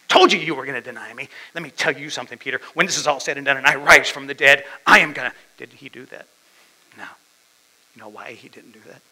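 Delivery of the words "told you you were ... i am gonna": loud, mock-furious telling-off; angry scolding. Preacher imagines Jesus berating Peter for denying him, angry scolding. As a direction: berate him, building louder to a threat.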